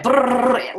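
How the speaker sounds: slow rolling brrr like a shutter burst; camera burst noise. Retold or imitated. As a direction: imitate rapid-fire shooting with the lips. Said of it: "I was like".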